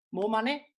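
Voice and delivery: high, loud, pleading for money; begging for tips. Real, not acted. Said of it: "so it's got desperate".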